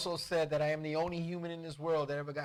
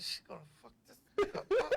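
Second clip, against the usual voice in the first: secret giggly mumble; 13 semitones higher, much faster than usual. Retold or imitated. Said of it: "I was mumbling inside".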